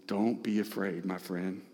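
low and hushed reassurance; gentle, soft comfort. Acted. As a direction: hold his face and calm him. Jesus comforts Jairus.

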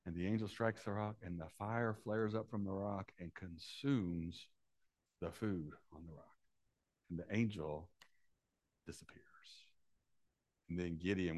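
soft, low, dramatic retelling; hushed storytelling. A narrator's reading. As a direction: draw them in, almost hushed.

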